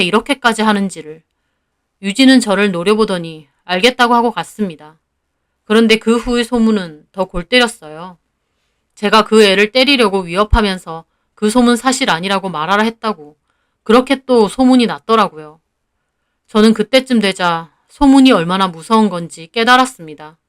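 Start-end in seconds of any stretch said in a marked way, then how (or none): none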